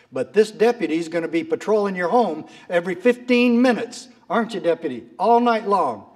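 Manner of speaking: loud, high voice as the sheriff laying down an order; acted emphatic order. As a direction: raise pitch and volume, make the deputy hear it. Sheriff loudly orders deputy in story.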